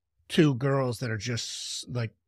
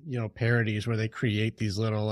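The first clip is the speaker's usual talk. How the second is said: normal talking voice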